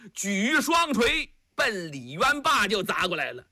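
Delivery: ordinary delivery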